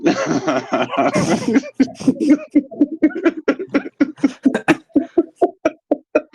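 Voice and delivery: high, wheezy cackling fit; real laughing fit in staccato bursts; long helpless laugh. Real, not acted. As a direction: crack up completely, high and long. Cracks up at the punchline.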